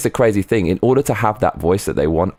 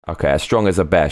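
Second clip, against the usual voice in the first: ordinary delivery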